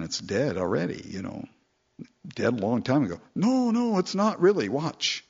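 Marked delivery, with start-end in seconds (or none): 3.3-5.2 high, forced insistence that all is fine; insistent denial. Retold or imitated. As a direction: protest too much in a high voice. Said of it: "a tendency within us to say".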